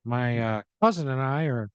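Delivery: high, slow, smug brush-off; Michael voice. Acted. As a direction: turn her down breezily, pitched high and drawn out.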